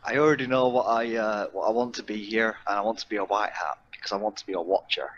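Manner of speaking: ordinary talk, nothing marked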